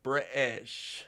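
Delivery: attempted British accent. Shown as a demonstration. Streamer testing a requested British accent on one word. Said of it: "Can I do a British accent?".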